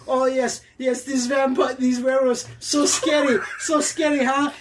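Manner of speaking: put-on sarcastic New Zealand accent; Kiwi accent. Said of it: "stop with the accent".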